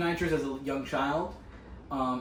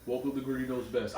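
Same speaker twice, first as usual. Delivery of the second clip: forcing a deep voice; 2 semitones lower, 5 dB quieter than usual. Pretend. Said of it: "you're literally faking it".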